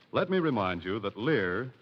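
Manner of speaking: normal talking voice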